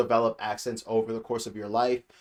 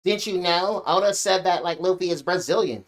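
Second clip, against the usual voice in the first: higher, mildly mocking retort; mock know-it-all; 4 semitones higher than usual. Reviewer quoting fans' defence of Luffy's accent, mock know-it-all. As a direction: imitate fans insisting on their excuse. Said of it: "a lot of people have been like".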